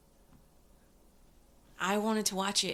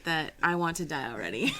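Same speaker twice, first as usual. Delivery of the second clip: laughing; 3 semitones lower, 4 dB louder than usual. Real, not acted. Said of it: "I have a comment".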